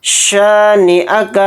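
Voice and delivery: शानिअक with a broad शीन and a stretched शा; full sheen. Shown as a demonstration. Teacher demonstrating a full शीन, slow. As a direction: open the शीन of शानिअक wide and draw out the शा. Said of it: "शाह को यहाँ पर थोड़ा खींचेंगे".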